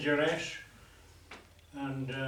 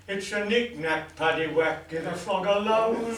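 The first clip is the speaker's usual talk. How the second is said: emphatic punchline; 4 semitones higher, 6 dB louder than usual. Acted. Manageress announcing her verdict on the ornament, an emphatic rising punchline. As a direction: build louder and higher to the last word.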